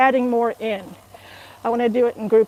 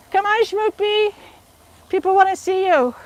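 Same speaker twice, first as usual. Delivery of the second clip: higher, sing-song coaxing; 8 semitones higher than usual. Real, not acted. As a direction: lure him over playfully. Coaxing husband onto camera.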